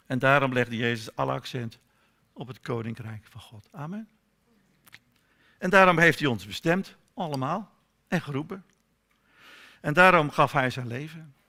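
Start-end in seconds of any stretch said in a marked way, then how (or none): none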